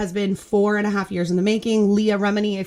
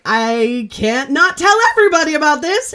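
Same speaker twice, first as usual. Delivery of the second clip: retold urgent outburst; 6 semitones higher, 6 dB louder than usual. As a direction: build to a high, loud exclamation. Retelling her urgent realization.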